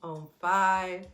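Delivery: soft, low meditation count; hushed, unhurried breathwork guide; guided breathing. Real, not acted. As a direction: count the breath out gently, with long pauses. Leads a slow breathing count.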